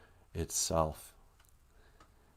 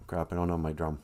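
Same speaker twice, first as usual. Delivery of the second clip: usual speaking voice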